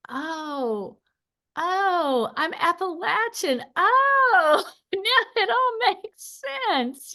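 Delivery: high, loud, laughing cry of discovery; sudden realization. Retold or imitated. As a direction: burst out with the epiphany, half laughing. Retold moment of sudden realization.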